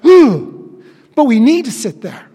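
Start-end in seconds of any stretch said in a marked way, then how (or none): 0.1-0.8 high loud shocked gasp; audible gasp. Retold or imitated. Preacher acting a viewer's shocked gasp at the portrait. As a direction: gasp as if seeing something gory. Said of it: "an audible gasp".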